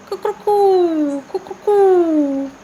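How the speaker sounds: high, loud cuckoo call; cuckoo call imitation. Shown as a demonstration. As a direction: sing out like a cuckoo. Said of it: "Can you tell me some more sounds of birds?".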